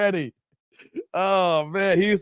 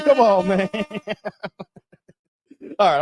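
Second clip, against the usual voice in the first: ordinary delivery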